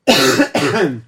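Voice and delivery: coughing fit after a sip; choking on a drink. Real, not acted. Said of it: "Regarde, il s'étouffe avec".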